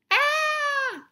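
sudden high scream of fright; high fish shriek. Acted. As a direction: shriek at the shark's open mouth. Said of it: "Stanley shrieked".

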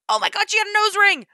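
high, loud burst of shock; excited shriek. Real, not acted. Spotting a nose ring in the trailer, excited shriek. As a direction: shriek it out in delight.

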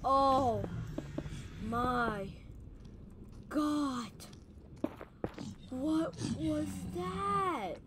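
slow, drawn-out aftershock; stunned, drawling exclamation; shaken disbelief. Real, not acted. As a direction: stretch each word out in stunned alarm. Shaken right after the attack.

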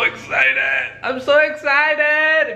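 squealed high and stretched slowly; hyped yelp. Real, not acted. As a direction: drag out the excitement in a high squeal. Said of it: "because I'm so".

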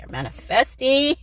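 drawn-out, high and loud cheer; excited. Real, not acted. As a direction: stretch it out in gleeful excitement. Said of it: "sorry, I'm getting excited".

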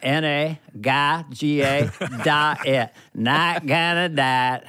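slow, mangled Bush catchphrase; worn-down Bush impression. Bush years later, mumbling the line slowly. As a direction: drag it out like it's fallen apart. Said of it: "By year four, it was like".

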